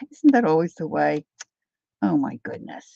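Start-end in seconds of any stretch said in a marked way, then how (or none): none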